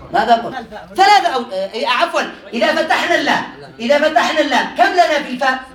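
usual speaking voice

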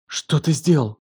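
mildly trembling accusation. A narrator's reading. Елена confronts him in the morning, trembling. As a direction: ask it with a slight tremble. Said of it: "Голос её дрожал".

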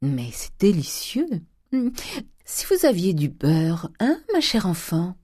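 slow, mannered Parisian gentleman; leisurely, affected drawl; affected young dandy. Acted. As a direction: play a pampered dandy savouring his food.

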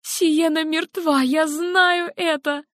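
high, slow and tearful; grieving mother. Acted. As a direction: drag out the words through grief. Helen insists daughter is dead.

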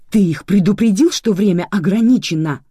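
indignant. Acted. Nika confronting Lozo about the guests, indignant. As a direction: demand it, boiling over. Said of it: "Полыхала от возмущения Ника".

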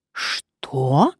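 drawn-out, incredulous "what"; indignant exclamation. A narrator's reading. Nephew hears he is not to be admitted, indignant exclamation. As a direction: question it with rising outrage. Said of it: "возмущённо воскликнул".

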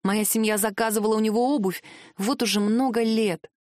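plain reading voice

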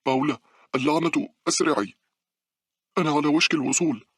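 high, loud urgent shout; screamed urging. A narrator's reading. Runner screams at her lagging friend, urgent high shout. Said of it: "صرخت مونيكا".

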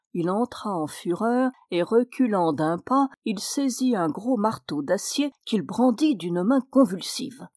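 ordinary delivery

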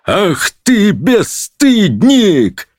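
high, slow scandalised cry; furious high outburst; thundering judge. A narrator's reading. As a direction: thunder the insult, stretching it out. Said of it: "загремел судья".

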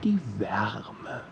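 soft, intimate whisper. A narrator's reading. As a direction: drop to a hushed whisper on the image. Poetic narration, whispered image.

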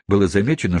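usual speaking voice

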